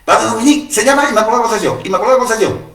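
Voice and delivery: high, loud words tumbling out fast; girl blurting the name she memorized to the priest, high and rushed; rushed and excited. Retold or imitated. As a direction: blurt it all out before you forget it. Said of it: "Se lo dijo muy rápido".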